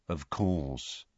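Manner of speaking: low, soft tomb-like reply; sepulchral. A narrator's reading. Mournful wizard surveying Harry, low and sepulchral. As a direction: murmur it low, like the grave. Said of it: "in a sepulchral voice".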